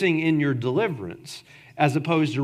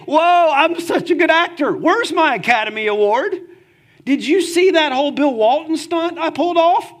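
much higher and louder, a hammed-up bragging show-off; mock boastful David; 11 semitones higher, 7 dB louder than usual. Preacher mocks David as a bragging actor for the congregation, hammed-up and loud. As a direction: play it like a smug actor fishing for applause. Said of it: "David doesn't say".

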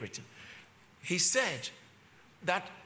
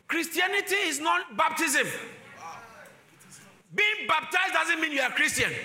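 forceful preaching; 8 semitones higher than usual. Acted. Preacher rises again.

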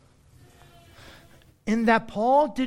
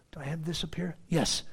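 quick whisper off mic; muttered whisper to himself; whispered aside; 4 semitones lower, 6 dB quieter than usual. Real, not acted. Preacher checking his slides in a whisper. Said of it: "aside to the screen".

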